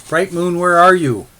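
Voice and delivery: high, loud panicked shout; panicked cry. A narrator's reading. Husband finding a dead wolf, shouting for his wife in panic. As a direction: call into the dark, terrified for her. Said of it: "He cried out in panic".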